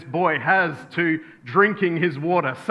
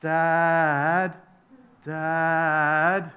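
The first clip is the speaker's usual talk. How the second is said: put-on long, held-out child's call for Dad; little boy calling. Little boy calls out from the bedroom, child's drawn-out call. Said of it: "a little voice called out".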